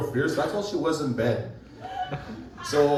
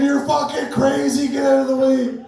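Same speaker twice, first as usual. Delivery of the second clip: much higher, louder shouted warning; mock yell; 9 semitones higher, 6 dB louder than usual. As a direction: yell at her to move. Shouting at girlfriend in fire.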